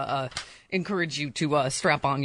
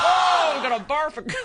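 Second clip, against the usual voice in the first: disgusted shout; 9 semitones higher than usual. Real, not acted. Disgusted after the excerpt.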